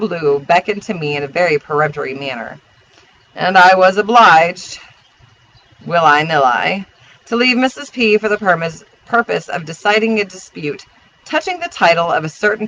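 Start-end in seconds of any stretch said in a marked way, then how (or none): none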